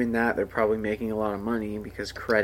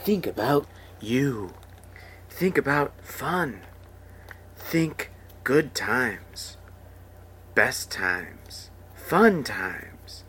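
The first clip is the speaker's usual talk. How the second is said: slow, breathy ad voiceover; mock casino voiceover; much slower than usual. Mocking the casino ad, dreamy voiceover. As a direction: drag out each phrase like a dreamy ad. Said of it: "It's just like".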